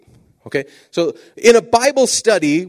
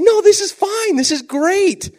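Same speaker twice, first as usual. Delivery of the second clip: excited reassurance; 5 dB louder, slower than usual. Retold or imitated. Reassuring the startled kids.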